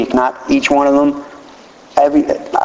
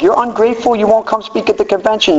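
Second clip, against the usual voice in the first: much higher, whiny accusing voice; mocking critics; 9 semitones higher than usual. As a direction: mimic nagging critics. People's complaint mimicked.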